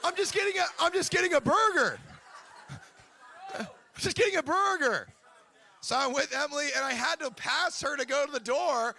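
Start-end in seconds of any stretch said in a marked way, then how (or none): 0.0-5.1 high, flustered protest; mock alarm. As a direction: plead innocence in a comically panicked high voice. Acting startled customer accosted by stranger.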